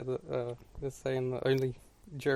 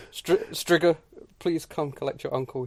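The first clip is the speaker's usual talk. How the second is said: normal talking voice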